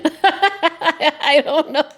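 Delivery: helpless real laughter, high pitched; can't stop laughing through the words. Real, not acted. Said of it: "That's just my real laugh".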